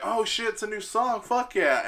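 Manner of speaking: high, thrilled burst; like hearing a new song live; excited fan. Retold or imitated. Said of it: "So I was like".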